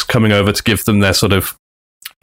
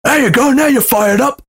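triumphant taunt; 13 semitones higher than usual. Retold or imitated. Austin to Big Show after the slap, mock shout. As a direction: crow it like you planned it.

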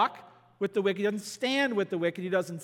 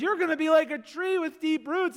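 much higher, bright promise; playful voicing of Psalm one's promise; upbeat psalm voice; 9 semitones higher, 4 dB louder than usual. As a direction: voice Psalm one as a cheery encourager. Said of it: "Psalm one says".

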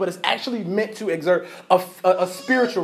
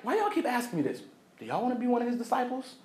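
much softer, confiding jab; leaning in, hushed; 4 semitones higher, 9 dB quieter, faster than usual. Retold or imitated. Man leans in, mocking Pharisees.

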